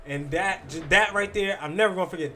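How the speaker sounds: ordinary talk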